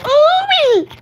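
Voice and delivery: put-on high squeal of shock from a little kid; a tiny child's scandalised ooh; shocked kid gasp. Kid reacting to hearing an adult cuss, high ooh. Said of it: "they're not gonna go".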